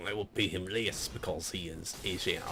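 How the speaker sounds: lightly hammed-up French-Canadian accent; mock-villain French accent. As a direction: imitate a sinister French Canadian.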